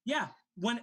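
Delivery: ordinary talk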